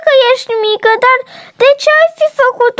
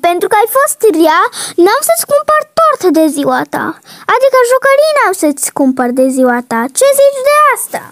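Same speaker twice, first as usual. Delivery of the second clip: stern mother; 2 semitones lower than usual. Acted. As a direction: scold her like a cross mom. Mother figure scolds child.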